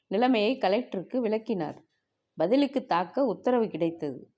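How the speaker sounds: ordinary delivery, nothing marked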